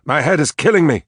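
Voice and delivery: high, loud outcry of pain; agitated yell, pitched high; agitated shout. A narrator's reading. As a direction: cry out with a splitting headache. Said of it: "Richard shouted, destroying the memory picture with his acute agitation".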